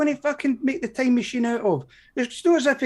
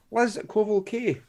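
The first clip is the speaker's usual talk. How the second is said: usual speaking voice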